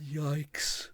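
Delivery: hushed whispered yikes. Real, not acted. Cringing at the career damage, whispering. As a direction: let it slip out in a whisper. Wincing aside.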